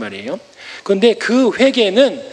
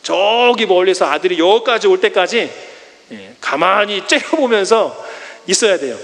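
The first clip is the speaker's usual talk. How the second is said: ordinary delivery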